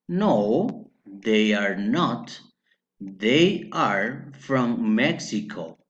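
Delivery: "No, they are not. They are from Mexico." very slow, word by word; slow model answer. Shown as a demonstration. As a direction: spell out "No, they are not. They are from Mexico." slowly, pausing between phrases. Teacher models answer slowly, then repeats.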